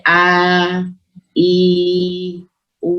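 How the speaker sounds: long, held "a, i, u", one at a time; long vowel demo. Shown as a demonstration. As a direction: draw out each vowel in "a, i, u" from the open mouth. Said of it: "Yang keluar dari al-jauf adalah huruf mat".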